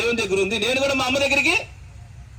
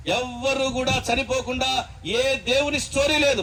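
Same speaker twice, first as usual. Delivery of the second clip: ordinary delivery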